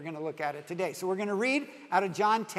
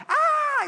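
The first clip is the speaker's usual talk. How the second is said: high shrill squeal, much louder; mock girlish squeal; 7 dB louder than usual. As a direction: squeal like a scared climber yanked off the rock. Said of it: "it's squeal".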